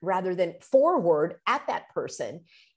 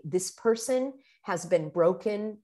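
ordinary delivery

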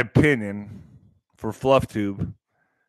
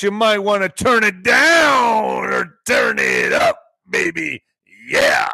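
slow, higher, loud hype; a stretched, louder hype call; hyped-up intro; 6 semitones higher, 3 dB louder, slower than usual. Acted. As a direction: pump the crowd up like a host. Hypes the next guest.

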